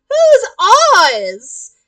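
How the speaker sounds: high, loud, puzzled yell; kid's yell. Acted. Kid questions the cosplayer's autograph, kid's yell. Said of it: "The kid yells".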